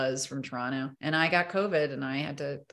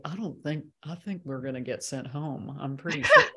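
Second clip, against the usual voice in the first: lightly voiced impression of a colleague, dropped lower; Margot Martindale impression; 3 semitones lower than usual. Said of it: "Very good impression".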